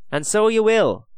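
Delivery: high, loud, sorrowful agreement; sad. A narrator's reading. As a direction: concede it with sorrow. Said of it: "said Dylan sadly".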